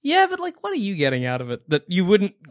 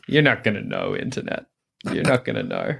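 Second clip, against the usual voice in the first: ordinary talk, nothing marked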